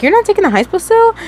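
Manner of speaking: high, fast disbelieving question; shocked question. Retold or imitated. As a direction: ask it in sudden disbelief. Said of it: "Hannibal be like".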